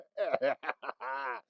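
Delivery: breathy giggle; quiet chuckle down the phone; soft high laugh. Acted. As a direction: giggle to yourself. Laughs on voicemail.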